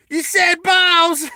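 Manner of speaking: high, loud, drawn-out juvenile heckle; silly high-pitched holler; gleeful mock outburst. Jokey immature outburst over rude word.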